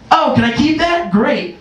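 high, sweet pretend asking; lightly voiced sneaky kid; mock innocent kid. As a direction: act innocent to grab it. Kid self faking innocence.

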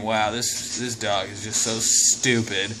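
quiet, slow, lightly voiced snooty cat; voicing the cat. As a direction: mutter it as the unimpressed cat. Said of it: "The cat's just saying".

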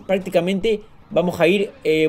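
ordinary delivery

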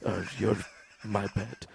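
put-on low, hushed, halting Indian accent; fumbling, nearly whispered Indian-accented caller; Vishnu. Nervous caller muddling his words to operator, hushed Indian accent. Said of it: "an Indian dude on the sex".

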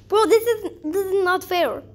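ordinary delivery

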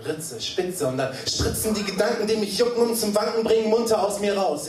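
rhythmic, rising spoken word; high-pitched poetic run; slam poetry recitation. Acted. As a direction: let the lines spill out in rhythm.

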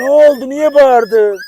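high, loud sudden concern; startled question. Real, not acted. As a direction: jump at the yelp and ask. Puppy suddenly yelps. (yüksek ve yüksek sesle şaşkın soru)

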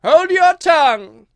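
high, very loud, drawn-out shout; enraged command. A narrator's reading. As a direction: silence her as a furious queen. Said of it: "turning purple".

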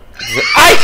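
sudden high shriek of fright; startled scream; scared shriek. Real, not acted. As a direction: yelp at the jump scare. Jump scare in horror game.

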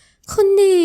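high, shy little cry of her name; bashful high cry. Acted. Ramon shyly answers Adisa's promise, high and bashful. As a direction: squeal the name, embarrassed.

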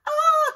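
high loud startled ah; alarmed cry. A narrator's reading. Broccoli finds his face drawn on, crying out. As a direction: yelp in shock. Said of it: "cried Broccoli".

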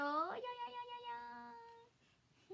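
high slow sing-song noise; playful trill. Real, not acted. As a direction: trill it for fun. 喜びの擬音. (高くのばして)

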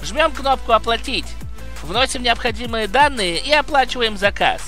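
brisk upbeat sponsor read. Acted. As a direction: sell it like a quick promo. (рекламная подача)

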